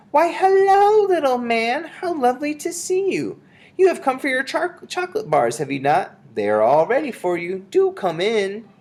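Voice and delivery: very high, falsely sweet welcome; sugary witch greeting. A narrator's reading. Disguised witch welcomes little man, high and sugary. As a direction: lure the child in with high-pitched sweetness.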